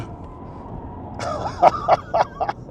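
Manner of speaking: long, high giggling laugh; gleeful laugh. Real, not acted. Driver enjoying the track, laughing gleefully. Thrilled driver on hot lap.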